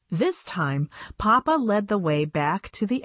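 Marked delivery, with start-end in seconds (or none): none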